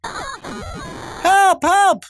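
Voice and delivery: very high, thin squeal for help; acted cry for help. Mother crying out from the burning house, acted.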